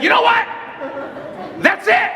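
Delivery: high-pitched exasperated outburst; lost it. Retold or imitated. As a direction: blow up in frustration, voice climbing high. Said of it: "I lost it".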